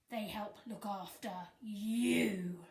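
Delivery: ordinary delivery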